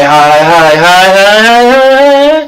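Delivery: demonstrating a high frequency with the voice. Shown as a demonstration. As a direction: step the word high up the scale, ending very high. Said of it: "high frequencies".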